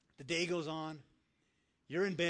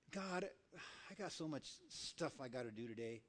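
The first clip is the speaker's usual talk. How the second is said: weary excuse; 3 semitones lower, 10 dB quieter than usual. Acted. Acted weary excuse to God.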